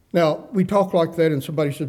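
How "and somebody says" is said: ordinary delivery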